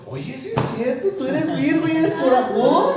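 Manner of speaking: high, loud mock astonishment at the horoscope; mock amazed believer. Co-host mocks a horoscope reader, loud and high mock amazement. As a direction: play the gullible reader, wide-eyed and loud.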